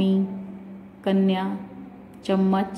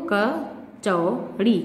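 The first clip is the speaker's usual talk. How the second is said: Kachori drawn out slowly, syllable by syllable; slow sounding out; much slower than usual. Shown as a demonstration. Teacher showing children the word kachori, sounded out slowly. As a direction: spell kachori out slowly for the kids. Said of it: "और यहाँ पे चा पे है".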